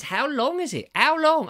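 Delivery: high, loud mock-dramatic question; mock suspense. Real, not acted. Teasing viewers about the cable's length, hammed up. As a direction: ham up the suspense.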